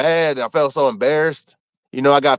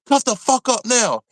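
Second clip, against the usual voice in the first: higher, slow mock guard order; retold guard yelling; 7 semitones higher than usual. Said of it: "they're, like, yelling at me".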